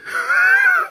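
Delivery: high delighted laugh. Real, not acted. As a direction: burst into a squeal of laughter. Delighted by the tea.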